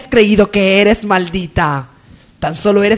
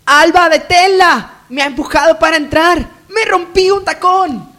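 outraged complaint; 6 semitones higher than usual. Acted. Lady of the house complains to the maid about an intruder, high and indignant. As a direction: protest like a spoiled lady of the house.

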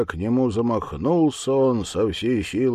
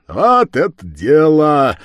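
loud, slow hearty cheer; burly lad's approval; 8 semitones higher, 12 dB louder, slower than usual. Acted. The first reveller cheers the plan, loud and hearty. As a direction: boom approval like a big reveller.

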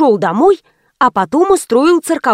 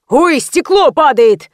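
drawn-out, mildly raised cry; yelled warning; 3 semitones higher, slower than usual. A narrator's reading. Man warning the crowd of falling glass, yelling. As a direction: call out a slow alarm. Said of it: "и тут Ленинит заорал".

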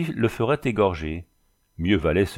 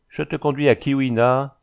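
usual speaking voice

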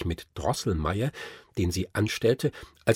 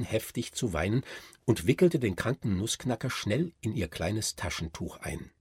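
ordinary delivery

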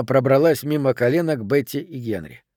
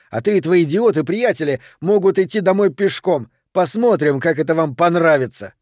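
higher, heated dismissal; indignant raised voice; angry parting shot; 4 semitones higher, 4 dB louder than usual. Acted. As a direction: fling a spiteful goodbye at the group.

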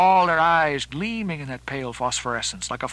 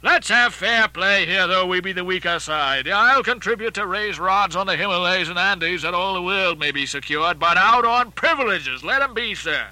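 raised-voice captain overruling his mate; higher, forceful oratory; Ahab; 4 semitones higher, 3 dB louder than usual. Acted. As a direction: declaim it defiantly to the crew. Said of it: "cried Ahab".

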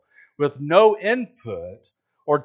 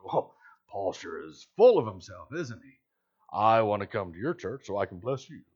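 sarcastic aside, lower and softer; mock sarcasm; 4 semitones lower, 5 dB quieter than usual. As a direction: imitate a sneering skeptic in an undertone. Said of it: "say sort of sarcastically".

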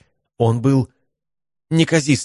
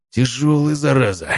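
rasped; 6 semitones higher than usual. A narrator's reading. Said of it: "прохрипел я".

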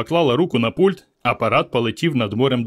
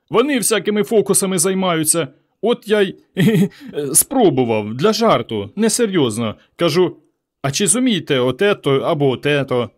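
mildly whining, higher-pitched excuses; whiny, tearful; 6 semitones higher than usual. A narrator's reading. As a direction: plead a little tearfully, with nervous giggles. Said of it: "плаксивим голосом сказав завгосп".

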